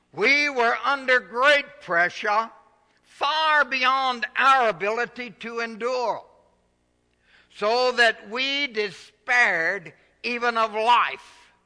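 loud, high, slow scripture proclamation; full-force preaching, slow. Acted. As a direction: proclaim it slowly to the whole church.

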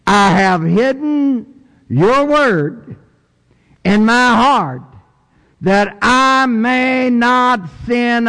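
high, drawn-out preacher's recitation; declaimed scripture. Acted. Preacher reciting a memorized psalm to his congregation, declaiming. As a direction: declaim the verse high, stretching the key words.

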